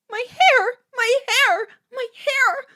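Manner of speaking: very high, loud hysterical shrieks; piercing cries of horror; shrieked. A narrator's reading. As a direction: wail it over and over. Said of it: "Hannah shrieked".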